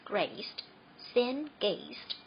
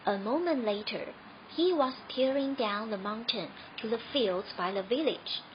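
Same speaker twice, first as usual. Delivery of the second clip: ordinary delivery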